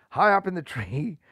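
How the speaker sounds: plain reading voice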